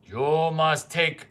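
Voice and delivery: ordinary talk, nothing marked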